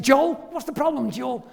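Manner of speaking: lightly voiced startled wife calling her husband's name; alarmed wife. Wife voiced in retold story.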